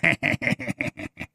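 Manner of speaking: unvoiced, staccato villain snicker; mock alien cackle. Mimicking scheming aliens' laugh.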